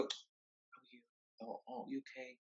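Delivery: hushed, breathless sickbed whisper; pitying whisper. Retold or imitated. Callers treating the ill man as fragile, whispered pity. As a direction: tiptoe around him in a pitying whisper. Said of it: "They go".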